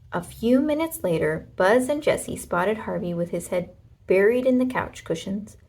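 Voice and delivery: ordinary delivery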